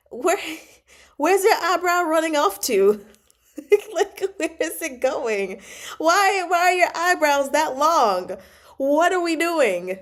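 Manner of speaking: loud, high, exasperated questions; mock outrage. Host questioning the prince about his endless eyebrows, mock-outraged. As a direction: wail in comic disbelief at the absurdity.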